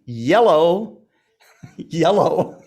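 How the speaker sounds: "yellow" as a drawn-out hello; dad-style greeting. Retold or imitated. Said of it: "And the dad frequency".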